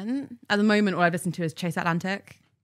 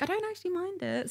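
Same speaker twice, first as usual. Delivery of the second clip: quoted friend; 13 semitones higher, 8 dB quieter than usual. Retold or imitated. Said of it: "she was like".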